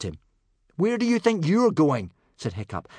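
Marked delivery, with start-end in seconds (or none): 0.7-2.1 high, loud challenge; challenging. A narrator's reading. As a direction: call out to stop someone leaving. Boy stops departing man.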